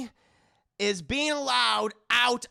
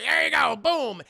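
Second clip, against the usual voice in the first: loud, punchy judge; loud declaration; 6 semitones higher, 9 dB louder than usual. Retold or imitated. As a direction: slam it down. Judge granting bail.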